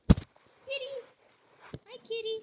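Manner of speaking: high, loud call to the kitty; light up at seeing the cat; excited greeting. Real, not acted.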